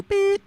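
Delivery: high sharp imitated beep; loud high electronic beep; answering machine beep. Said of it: "listen to her messages like this".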